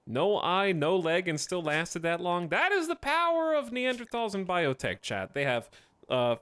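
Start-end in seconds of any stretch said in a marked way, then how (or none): 2.5-5.0 excited hype. Real, not acted. Hyped gaming commentary.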